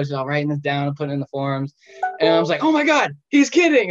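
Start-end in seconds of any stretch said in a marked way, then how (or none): 2.6-3.9 much higher, louder squeal of disbelief; shocked disbelief. Retold or imitated. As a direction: gasp it out, can't believe it. Retelling disbelief at nurse.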